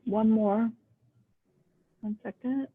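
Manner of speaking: normal talking voice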